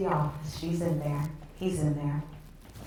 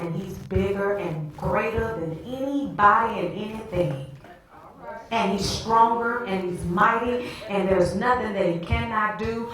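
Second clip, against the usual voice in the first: higher, driving preacher's proclamation; full-force preaching; 4 semitones higher, 4 dB louder than usual. Real, not acted. Preacher telling the congregation God is stronger and mighty, preaching at full force. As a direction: build into a confident, ringing declaration. Preaches about God's strength.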